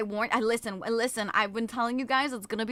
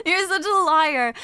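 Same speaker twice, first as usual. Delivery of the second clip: high, loud, through laughter; playful accusation on the tail of a laugh; 5 semitones higher, 7 dB louder than usual. Real, not acted. Streamer teasing a fibbing viewer, laughing.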